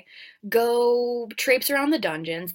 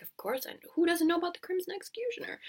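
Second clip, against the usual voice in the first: higher, fast, softer scoffing; fast high scoffing reply; dismissive models; 8 semitones higher, 7 dB quieter, much faster than usual. Acted. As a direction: brush it off quickly.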